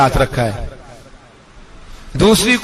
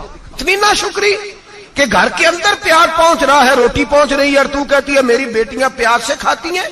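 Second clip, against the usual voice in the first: indignant wrath; 7 semitones higher than usual. Retold or imitated. Caliph reproaching his wife over her complaint, wrathful. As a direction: act out outraged disbelief at ingratitude. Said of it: "حضرت عمر جلال میں آ گئے".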